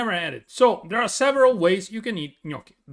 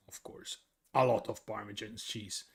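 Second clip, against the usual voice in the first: a secret confided in a breath; whispered aside; 8 semitones lower, 9 dB quieter than usual. Real, not acted. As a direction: whisper it, no voice at all. Aside about cheese.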